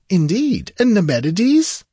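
high, swooping, inquiring voice; high-pitched polite surprise; surprised sorcerer. A narrator's reading. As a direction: ask it high, with lifted astonishment. Stranger surprised at new king.

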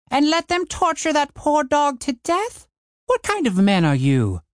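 high, indignant outburst; outraged dwarf woman. Acted. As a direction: shame them with outrage. Jorn outraged at the men.

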